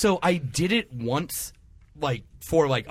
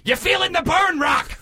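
put-on much higher shouted old trainer voice; Burgess Meredith impression. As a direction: bark it at a boxer like a grizzled coach. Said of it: "Burgess Meredith".

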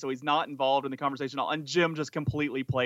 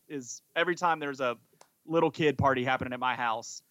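ordinary delivery

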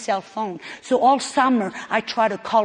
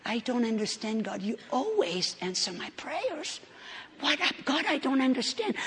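softer, a touch weary, retold prayer; discouraged murmured prayer; 3 semitones higher, 10 dB quieter than usual. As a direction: murmur it to God, half-defeated. Quoted bedtime prayer to God.

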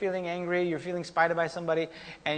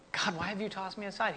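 quiet lament; 2 semitones higher, 8 dB quieter than usual. Retold or imitated. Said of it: "what got in Scripture was".

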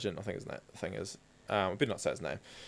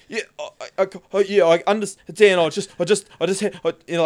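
flustered cop; 6 semitones higher, 7 dB louder than usual. Retold or imitated.

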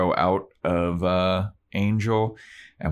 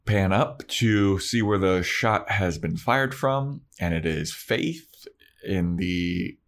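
ordinary delivery

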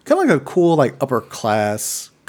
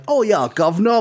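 hammed-up British accent, pitched higher; mock British accent; 7 semitones higher than usual. Mocking Charlie Hunnam's accent to a friend, hammed-up British. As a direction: imitate a very British actor, exaggerated and high. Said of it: "Charlie Hunnam's British accent".

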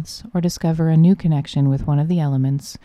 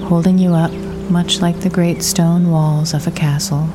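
guided meditation. Acted. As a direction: guide the listener gently into the imagery, unhurried.

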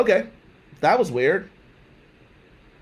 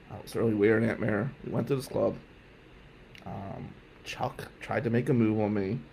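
low, softer, hesitant reply; awkward confession; 5 semitones lower, 7 dB quieter than usual. Retold or imitated. Nephew explaining the club to his aunt, awkward and low. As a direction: admit it sheepishly under your voice.

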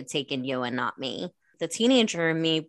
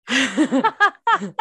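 laughing through the word; 8 semitones higher, 6 dB louder than usual. Real, not acted. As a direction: break into giggles on it. Sister laughing mid-sentence.

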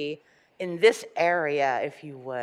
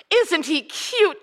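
higher, slower, delighted exclamation; delighted cry; 9 semitones higher, 3 dB louder, slower than usual. Acted. Raggedy Andy seeing the elf, delighted cry. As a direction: gush over the little elf. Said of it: "cried Raggedy Andy".

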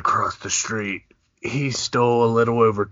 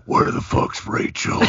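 low threatening jittery hiss. Pretend. Acting a robber at the teller.

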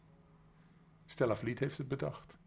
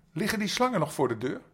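calling out; 8 semitones higher, 9 dB louder, faster than usual. Acted. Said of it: "riep Habuli".

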